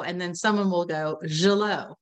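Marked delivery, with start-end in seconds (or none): 1.3-1.9 Gilot as French, zhee-LOH with a silent T; French pronunciation. Shown as a demonstration. Trainee out of options, Gilot pronounced as French. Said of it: "They'll turn it into French".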